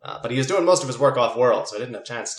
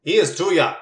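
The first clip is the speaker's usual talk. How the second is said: stern uncle; 6 semitones higher, 7 dB louder than usual. Acted. Uncle snaps at cousin.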